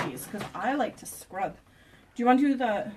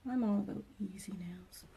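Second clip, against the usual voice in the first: soft unvoiced whisper; 8 dB quieter than usual. Real, not acted. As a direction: whisper it to yourself. Quiet aside while working.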